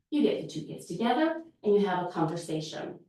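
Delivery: normal talking voice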